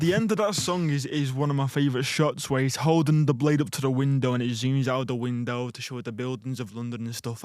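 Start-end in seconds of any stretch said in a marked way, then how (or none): 5.0-7.4 trailing off softly, zooming out; fading quieter. Real, not acted. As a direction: fade the voice away. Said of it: "You got quieter then".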